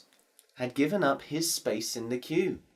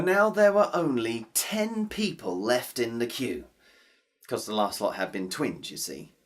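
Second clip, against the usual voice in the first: plain reading voice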